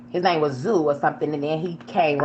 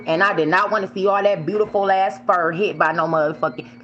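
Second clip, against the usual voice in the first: ordinary delivery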